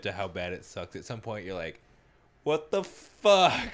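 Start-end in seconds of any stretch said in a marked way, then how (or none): 2.4-3.7 slow, high, loud mock outburst; hammed-up cry of disbelief; exasperated disbelief. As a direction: stretch it out, incredulous. Imagined reaction to endless misery.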